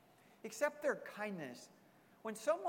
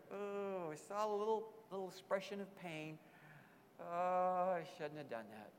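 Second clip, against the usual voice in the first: retold regret; 3 dB quieter than usual. Pastor retelling a friend's regret after a joke, rueful.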